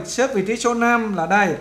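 ordinary talk, nothing marked

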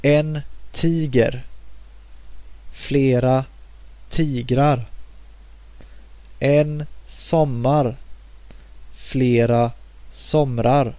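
slow, careful Swedish: En tiger, flera tigrar, en sommar, flera somrar; teacher modelling Swedish plurals; Swedish demo words. Shown as a demonstration. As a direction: say each Swedish form clearly as a model for learners. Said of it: "in the singular form before you add the plural suffix".

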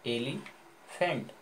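Elephant in two slow parts, एली, pause, फेंट; split into syllables. Shown as a demonstration. Teacher showing how a word is read in parts, split slowly. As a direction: say elephant broken after एली, drawn out. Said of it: "एली एक word में पढ़े, दोबारा क्या पढ़े?".

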